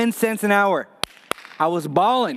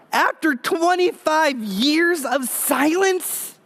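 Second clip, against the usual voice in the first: slow dramatic emphasis; 9 semitones higher, slower than usual. Acted. Preacher dramatic pause.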